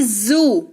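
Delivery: जू with a buzzing z, as in dotted ज़; slow model of जू starting on z; Z sound. Shown as a demonstration. Teacher showing Z's sound, जू with a buzzed z. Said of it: "जिसका उच्चारण ज होता है".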